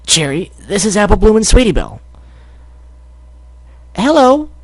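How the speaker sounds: high filly voice. Acted. Fillies introducing each other, high voices. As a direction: greet as a little filly.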